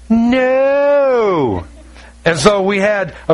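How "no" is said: long, high drawn-out no; emphatic refusal. Retold or imitated. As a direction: stretch out a mock-dramatic refusal. Acts out refusing wife's plea to save.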